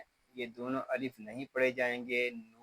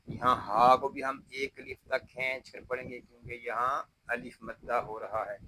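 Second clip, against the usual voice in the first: ordinary talk, nothing marked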